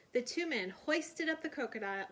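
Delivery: usual speaking voice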